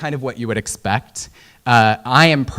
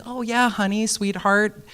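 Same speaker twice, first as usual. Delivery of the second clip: much higher, sugar-sweet helpful voice; syrupy Midwestern helper; 9 semitones higher than usual. Retold or imitated. Local sweetly pointing a lost tourist the way, sugary high voice. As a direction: pour on the honey like a doting local.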